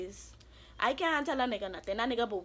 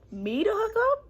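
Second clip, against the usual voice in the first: outraged disbelief; 12 semitones higher, 3 dB louder, slower than usual. Real, not acted. Outraged at being called hooker.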